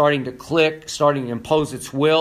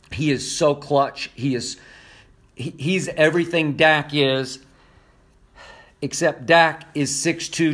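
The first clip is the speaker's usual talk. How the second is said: normal talking voice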